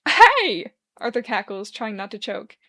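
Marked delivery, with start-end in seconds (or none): 0.1-0.7 high, loud startled cry; surprised yelp. A narrator's reading. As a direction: jump at it. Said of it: "Arthur jumps in surprise".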